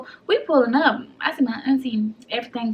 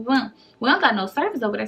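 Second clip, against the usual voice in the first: normal talking voice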